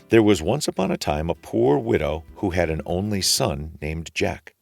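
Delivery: usual speaking voice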